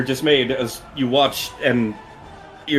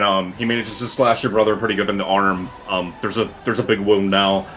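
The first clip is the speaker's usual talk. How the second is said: normal talking voice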